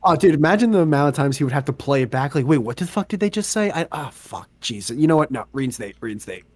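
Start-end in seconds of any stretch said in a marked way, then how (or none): 2.5-6.4 acted quiet, sinking defeat; weary exasperation. Worker replaying the video gives up, quietly exasperated. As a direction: sigh it out softly, giving up.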